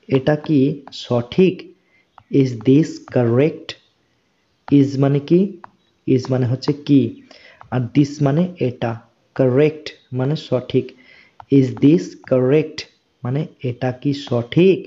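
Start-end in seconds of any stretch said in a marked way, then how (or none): 2.4-3.7 very slow, each word drawn out for learners; slow model reading. Shown as a demonstration. Teacher reading a question slowly to Bengali students. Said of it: "teacher models English phrase". 11.6-12.8 very slow, each word drawn out for learners; slow model reading. Shown as a demonstration. Teacher repeating the question slowly for students. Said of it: "teacher models English phrase".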